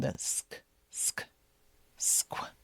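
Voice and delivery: sk sound alone, whispered; sk, squ- breathed out unvoiced. Shown as a demonstration. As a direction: isolate the sk of squirrel. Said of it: "the Q U sound".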